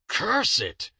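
a leader cursing the drifting globes, loud cry; cried out. Acted. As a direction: burst out cursing. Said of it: "cried the leader".

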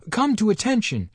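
put-on a boy trying to roar and squeaking; failed thunder. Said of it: "he tried to thunder".

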